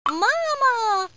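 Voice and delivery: high doll voice calling out; high, drawn-out toy doll cry; talking doll. A narrator's reading. Hidden doll calls out from inside the bread, talking doll voice. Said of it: "Inside is Sophie's talking doll".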